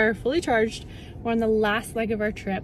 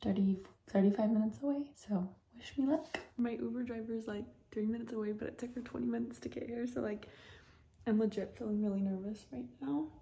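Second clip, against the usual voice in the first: nervous whisper; 12 dB quieter than usual. Real, not acted. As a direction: whisper it, worried about the flight.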